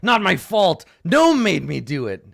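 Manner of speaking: high loud gnoll excuse; first gnoll. Acted. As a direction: blame the gnome, whining. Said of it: "the other one is like".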